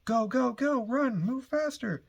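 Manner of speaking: high, loud mock panic shouts; frantic crowd screaming to run; panicked yelling. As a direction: yell at people to run from danger, voice way up. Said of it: "there's people screaming, yelling".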